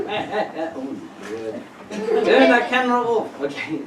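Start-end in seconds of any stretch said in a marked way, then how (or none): 0.1-1.6 low, soft, breathless mock gasp of shock; gasping blonde lady. Mimicking the blonde lady in the crowd, soft low gasps. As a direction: gasp it out, almost fainting. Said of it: "she's like".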